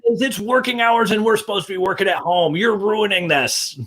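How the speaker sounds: put-on high, indignant old-man scold; grumpy old man. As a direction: scold the noisy neighbours like a cranky old man. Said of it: "your best old man voice".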